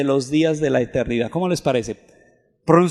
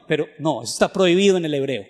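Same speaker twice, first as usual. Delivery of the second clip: ordinary delivery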